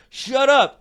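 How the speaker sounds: high, loud dad yell; retold yell. Dad bursting in on sleep-talking son, mock yell. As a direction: imitate dad yelling from the doorway. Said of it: "he'll be like".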